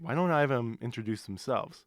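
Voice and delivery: usual speaking voice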